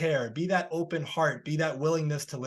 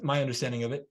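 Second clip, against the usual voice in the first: normal talking voice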